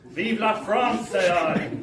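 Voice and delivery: loud, high, drawn-out patriotic cheer; exultant cry. Acted. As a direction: proclaim it with relish, stretching it out. Eager exclamation before seeing his girlfriend.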